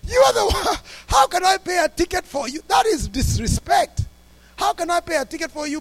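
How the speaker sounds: high-pitched, heated retold protest; loud at first, scolding in outrage; indignant inner outburst. Pastor silently answering the man he paid for, retold indignant outburst. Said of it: "in my heart, I'm saying".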